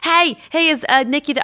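a roughly imitated accent, pushy phone caller; Patty Stanger. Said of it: "I'm not doing her accent well".

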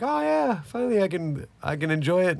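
high, eager mock delight; hammed-up high glee; mock excitement. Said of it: "and I'm like".

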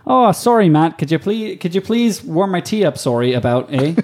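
put-on overly polite Canadian accent, eh at the end; mock Canadian accent asking her servant nicely. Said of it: "Do it in the Canadian accent".

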